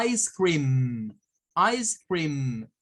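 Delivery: ICE CREAM said "ais criim", drawn-out ending; English pronunciation demo. Shown as a demonstration. Teacher giving English for sorbete, careful demo. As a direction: model ICE CREAM word by word. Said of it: "sorbete en inglés".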